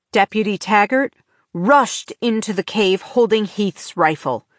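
plain reading voice

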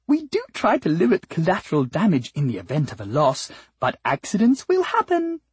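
high, sing-song, over-polite voice; sweet flower singer. Acted. Flower justifying concern for the cat's species, high and sugary. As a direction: explain sweetly, lifting high at phrase ends. Flower explains herself to the cat.

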